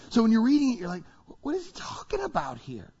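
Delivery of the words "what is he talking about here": high-pitched, softer mock bewilderment; baffled reader. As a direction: act out a puzzled reader's disbelief.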